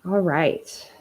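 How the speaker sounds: ordinary talk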